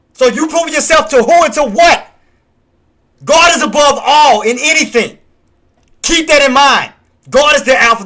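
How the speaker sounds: booming, preacher-style proclamation, each line punched hard; loud preaching rant. Real, not acted. Streamer preaching to his viewers, loud and fervent. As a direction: preach it at full force about God. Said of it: "sorry about the rant".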